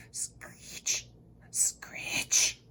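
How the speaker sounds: soft unvoiced creepy screech; spooky whispered screeching sound. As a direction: hiss out the noise. Spooky window noise.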